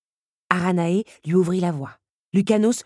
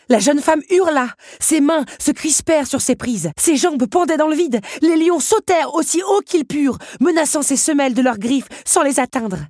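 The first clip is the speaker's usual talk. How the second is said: higher, louder breathless narration; tense climactic narration; 9 semitones higher, 5 dB louder than usual. A narrator's reading. Narrator tells of Belle dangling above leaping lions, tense and loud. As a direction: read it fast and urgent at the peak of danger.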